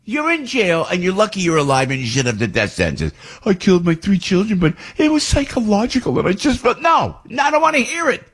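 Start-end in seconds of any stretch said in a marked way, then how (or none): 3.5-6.8 mock whiny excuse. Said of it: "mocking a killer's excuse". 6.8-8.2 exasperated, high refusal. Real, not acted. Cutting off mocked excuse.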